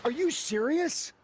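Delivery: high, drawn-out disbelief; incredulous outburst. Acted. Wrestler reacting to news of Hunter's favoritism, incredulous. Incensed reaction in scripted story.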